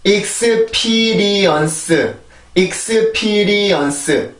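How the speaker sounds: "익스피리언스" slowly, every Korean syllable full; Korean-style pronunciation. Shown as a demonstration. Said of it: "우리나라 말로 일단 표기를". (한국어 표기대로 느리게 "익스피리언스")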